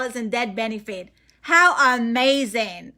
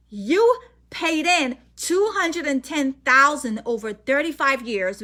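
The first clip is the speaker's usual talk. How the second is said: usual speaking voice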